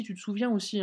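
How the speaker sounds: ordinary talk, nothing marked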